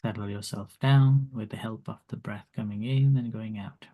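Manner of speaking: soft, calm meditation guide; calm grounding exercise. Acted. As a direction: guide them gently into the body. Leads meditation.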